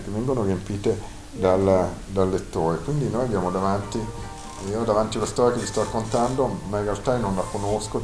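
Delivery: ordinary delivery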